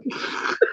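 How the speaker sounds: breathless wheezing laugh; silent wheeze laugh. Real, not acted. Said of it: "Let me wipe my tears".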